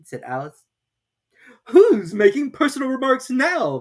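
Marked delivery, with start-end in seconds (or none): 1.7-3.8 loud, higher gloating Hatter; triumphant Hatter. A narrator's reading. Hatter scoring a point off Alice, triumphant. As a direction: crow it out in victory. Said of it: "the Hatter asked triumphantly".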